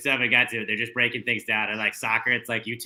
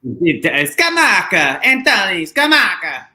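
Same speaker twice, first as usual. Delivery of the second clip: very slow, much higher and louder drawn-out "Scamacca"; savouring the name; 6 semitones higher, 8 dB louder, much slower than usual. Pretend. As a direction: stretch every syllable of "Scamacca" like a commentator. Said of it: "extremely fun name to say".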